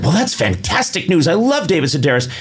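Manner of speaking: lightly voiced gushing clerk. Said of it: "And she went".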